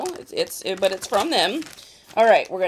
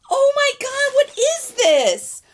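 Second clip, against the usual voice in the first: high, loud amazed cry; stunned, happy exclamation; 13 semitones higher, 4 dB louder, slower than usual. Real, not acted. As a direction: gasp it out in delight. Finds another gift.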